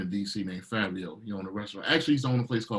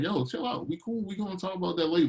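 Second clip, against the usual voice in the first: high, fast easygoing voice; breezy brush-off, quick and high; laid-back; 9 semitones higher than usual. Retold or imitated. Others telling him to relax.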